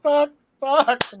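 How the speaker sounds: high, loud giggly swearing; helpless giggly cursing. Real, not acted. Stoned storyteller who lost his place, helpless giggly cursing. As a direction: curse through the tail of a giggle fit.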